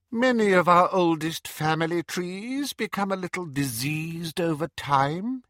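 high, cold, silky menace; sinister dark lord; Voldemort voice. Acted. Voldemort addresses Death Eaters.

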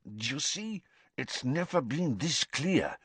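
a touch of panic he tries to hide; agitated, lips still. A narrator's reading. Karkaroff showing Snape the mark, agitated and hushed. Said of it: "not to move his lips".

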